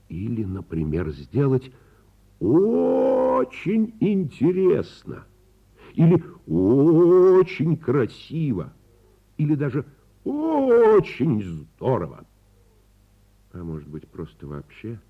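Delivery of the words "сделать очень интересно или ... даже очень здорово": high, loud, stretched очень each time; a wizard's rising, drawn-out delight; growing excitement. Acted. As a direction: build up the wonder of each очень. Wizard dreams, building excitement.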